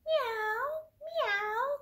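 high-pitched, drawn-out mewing; cat meow. Acted. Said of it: "cried the cat".

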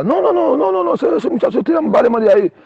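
high falsetto protest, imitated; Viva. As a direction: object in a squeaky put-on voice. Acts Viva rejecting the offer.

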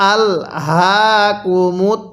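each syllable drawn out slowly; slow demo reading. Shown as a demonstration. Teacher demonstrates the verse slowly for students. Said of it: "কিভাবে পড়ব?".

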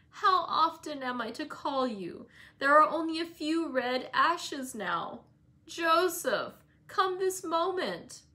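peevish invalid. A narrator's reading. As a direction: whine the orders like a spoilt sick boy. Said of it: "a peevish voice".

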